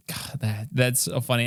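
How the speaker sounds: ordinary delivery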